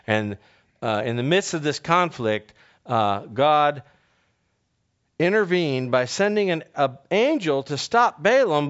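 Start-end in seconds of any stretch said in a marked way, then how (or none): none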